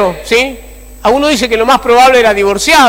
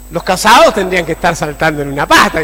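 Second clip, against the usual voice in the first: usual speaking voice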